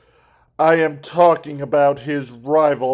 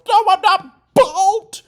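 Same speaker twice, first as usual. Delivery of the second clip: Squidward yelling. Retold or imitated. Retelling the cartoon, Squidward yells at SpongeBob high. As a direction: bellow it like an offended Squidward. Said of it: "Squidward yells".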